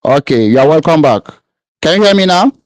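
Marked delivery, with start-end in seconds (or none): 1.8-2.5 high, raised calling voice; across the street. Real, not acted. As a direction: call out to a lost caller. Caller can't hear host.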